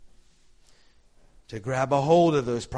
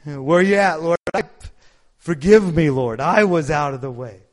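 louder, urgent plea for forgiveness; pleading to God; 2 semitones higher, 8 dB louder than usual. Retold or imitated. A stray believer calls to the Lord, loud and pleading. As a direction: beg the Lord to forgive you. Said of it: "We're going".

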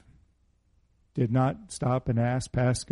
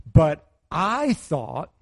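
imitating his wife; 3 semitones higher, much slower than usual. Quoting wife's second question.

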